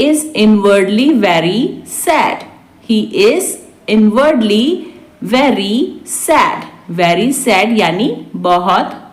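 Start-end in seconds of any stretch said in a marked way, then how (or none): 2.9-6.7 spaced out for learners; slow repeat. Shown as a demonstration. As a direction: draw out the closing words. Teacher repeats translation word by word. (धीरे-धीरे, खींचकर)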